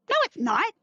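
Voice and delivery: sudden high, loud no; blurted denial. Retold or imitated. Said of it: "I said out loud".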